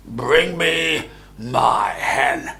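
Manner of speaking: loud, slow order to his wife; giant's command. A narrator's reading. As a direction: drag out the demand.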